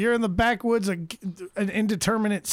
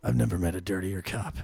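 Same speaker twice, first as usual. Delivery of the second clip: gruff cop line; 13 semitones lower, 6 dB quieter than usual. Retold or imitated. Quoting movie line.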